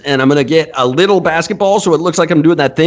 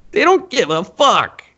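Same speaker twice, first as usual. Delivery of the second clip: ordinary talk, nothing marked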